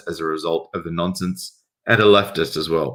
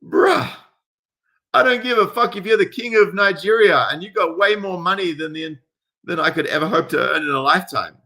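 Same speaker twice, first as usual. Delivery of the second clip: much higher, ranting at an entitled tourist; heated rant; 9 semitones higher than usual. Real, not acted. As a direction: let the outrage boil over.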